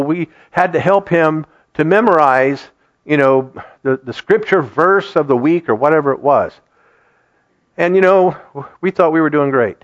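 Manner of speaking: usual speaking voice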